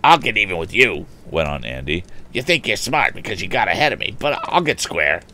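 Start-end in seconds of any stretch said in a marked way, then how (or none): 0.0-1.1 put-on raised, heated boyish threat; worked-up bully; bully's threat. Bully threatens his rival. 2.3-5.2 higher-pitched, put-on bully voice; worked-up bully vowing revenge; bully's threat. Bully threatens his rival.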